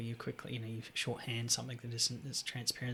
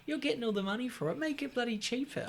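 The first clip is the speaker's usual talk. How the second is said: indignant rant; 12 semitones higher than usual. Retold or imitated. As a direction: squeal it like a fed-up student. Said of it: "I'm like".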